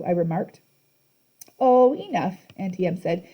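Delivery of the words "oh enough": put-on sweet old lady. A narrator's reading. Auntie Em character voice.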